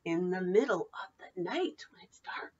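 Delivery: hushed whisper for the dark night; bedtime-story whisper. Acted. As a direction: whisper it like a secret. Spooky night line to children.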